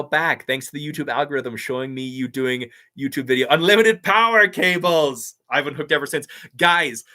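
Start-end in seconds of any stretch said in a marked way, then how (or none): 3.5-5.4 loud, high, drawn-out catchphrase; hammed-up shout. Streamer quoting his own viral video, hammed-up shout. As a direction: belt it out slowly like a movie villain.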